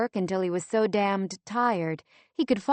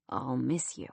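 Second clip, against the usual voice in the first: quietly; 6 semitones lower, 5 dB quieter than usual. A narrator's reading. Monty to Lindsay before leaving, soft and low. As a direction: lower and softer goodbye. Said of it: "Monty said quietly".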